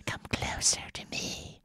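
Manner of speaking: put-on eerie whispered child voice; creepy child whisper. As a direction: breathe it like a ghost child. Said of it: "her creepy child voice".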